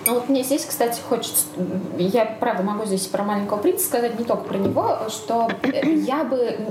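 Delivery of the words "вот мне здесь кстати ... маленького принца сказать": ordinary talk, nothing marked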